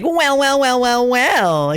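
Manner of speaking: high, drawn-out gloating well well well; mock sinister singsong; villain gloat. Shown as a demonstration. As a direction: purr it like a smug villain. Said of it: "to being like".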